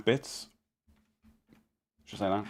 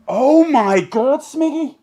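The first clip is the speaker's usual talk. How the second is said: loud, high and slow shocked cry; shocked shout; 13 semitones higher, 10 dB louder, much slower than usual. Real, not acted. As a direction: yell his name in disbelief. Shock at a big donation.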